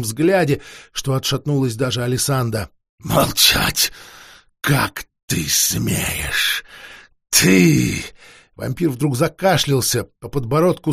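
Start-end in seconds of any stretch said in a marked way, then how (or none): 3.0-8.0 hissed, then slow and menacing; hissing rage. Acted. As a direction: seethe with contempt. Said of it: "яростно зашипел".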